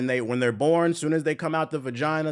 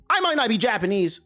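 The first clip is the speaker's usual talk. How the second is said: mocking comment voice; 8 semitones higher than usual. Mock-reading a comment.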